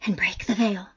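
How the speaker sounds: whisper. Acted. Eerie quote recited.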